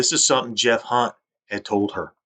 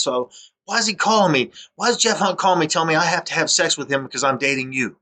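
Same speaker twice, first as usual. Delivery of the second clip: retold yelling; 7 semitones higher than usual. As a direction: fire off the accusation, pitched well up. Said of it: "starts yelling at me".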